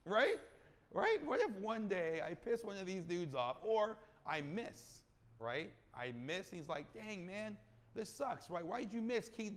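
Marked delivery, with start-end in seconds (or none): 0.1-5.0 anxious excitement. Real, not acted. Imagining an investor getting mad. 6.9-9.6 mock upset investor.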